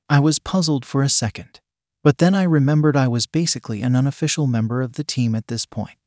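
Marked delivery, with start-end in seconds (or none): none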